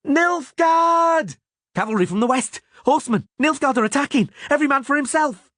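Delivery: very high, panicked alarm shout; piercing cry. A narrator's reading. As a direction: raise the alarm, racing at the end. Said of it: "drowned out by a piercing cry".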